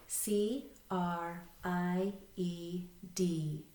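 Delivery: slow, letter-by-letter spelling; spelling aloud. Shown as a demonstration. Teacher leading learners in read, spell, read, spelling aloud. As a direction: spell it out slowly, a gap after each letter. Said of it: "Read, spell, read with me".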